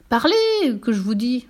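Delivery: high shouted command, then dropping; irate circus boss; exasperated order. Acted. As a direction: lose patience and order it. Angry director orders, turning red.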